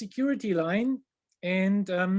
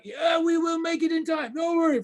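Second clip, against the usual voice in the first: much higher, louder, breezy driver voice; hammed-up cocky driver; 10 semitones higher, 4 dB louder than usual. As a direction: play the speeding driver brushing off worry. Driver reassuring while speeding.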